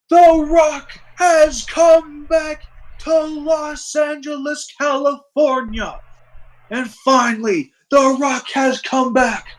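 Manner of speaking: high, slow, booming wrestler promo; The Rock impression. Player to friend, retelling the wrestler's return, slow high booming promo voice. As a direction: ham it up like a wrestler cutting a promo to the crowd. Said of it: "He says".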